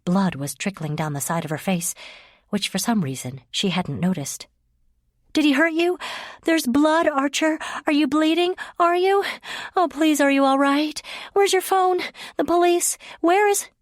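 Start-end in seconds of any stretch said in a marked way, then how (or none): 5.3-13.7 high, loud, breathless panic; frantic panic. Acted. Leah panicking over bleeding Archer, high frantic questions.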